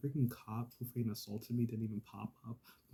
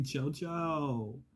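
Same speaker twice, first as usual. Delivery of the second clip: mock call-out to another cell, raised pitch; mock yelling; 7 semitones higher, 7 dB louder, slower than usual. Mocking inmates who yell between cells, mock yelling. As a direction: imitate inmates hollering gossip, louder at the end. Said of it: "I'm not gonna yell to JoJo".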